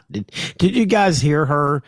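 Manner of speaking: ordinary talk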